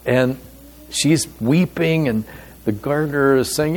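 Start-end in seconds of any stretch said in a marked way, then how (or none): none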